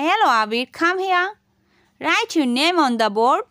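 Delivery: each word drawn out slowly for the class; slow model reading. Shown as a demonstration. Teacher reading a classroom command slowly to pupils. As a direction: read it slowly so students can copy. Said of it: "তাহলে আমরা এভাবে বলতে পা-, পড়তে পারি".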